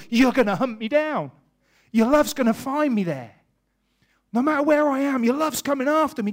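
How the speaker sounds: loud, high, impassioned sermon voice; fervent preacher's cry; preaching at full force. Acted. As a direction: preach it like David crying out. Preaching David's psalm at full force.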